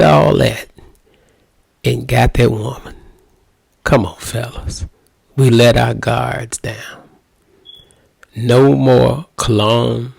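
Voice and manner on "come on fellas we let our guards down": usual speaking voice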